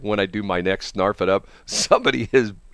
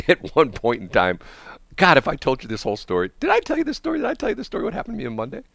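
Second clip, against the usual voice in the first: normal talking voice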